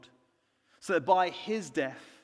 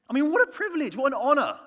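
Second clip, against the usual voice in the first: much higher and louder; awed excitement; 9 semitones higher, 7 dB louder, faster than usual. Real, not acted. Preacher marvelling at serving the Queen, excited. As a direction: marvel at the honour out loud.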